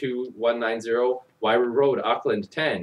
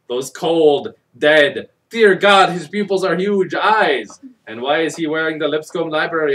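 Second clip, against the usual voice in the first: louder, rising mock-horrified build; mock horror; 5 semitones higher, 8 dB louder than usual. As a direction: ham up the creepy eyes, getting louder. Mock horror at grandfather's eyes.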